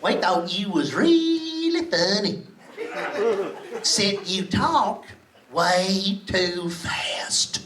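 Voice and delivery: put-on lazy southern girl, slow and low; slow Mississippi drawl. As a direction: drag every word out like a sleepy Mississippi local. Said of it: "She goes like this".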